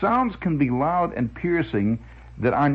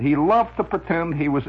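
ordinary talk

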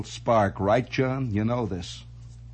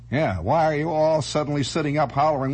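ordinary talk, nothing marked

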